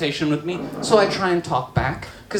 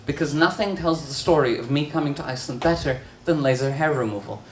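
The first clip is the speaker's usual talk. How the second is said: usual speaking voice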